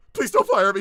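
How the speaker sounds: high, loud mock begging; panicked plea. Pleading with the director not to fire him, loud and panicked. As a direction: yelp it like a terrified new hire.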